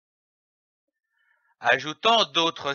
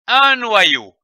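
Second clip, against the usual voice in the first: "Un noyau" higher and run together; "Un noyau" without breaks, starting high; whole word joined; 4 semitones higher, 4 dB louder than usual. Shown as a demonstration. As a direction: blend "noyau" into one connected word. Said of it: "le mot noyau".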